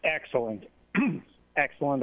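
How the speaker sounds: ordinary talk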